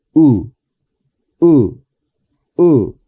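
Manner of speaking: "U" with lips barely parted, short; short English U. Shown as a demonstration. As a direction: say "U" relaxed, lips apart. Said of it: "y decimos".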